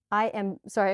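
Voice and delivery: ordinary delivery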